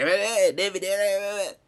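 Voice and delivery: high, far-off voice of protesting listeners; mock protest. Listeners heckling David in a high protesting voice. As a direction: heckle David with repeated hey. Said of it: "some people are like".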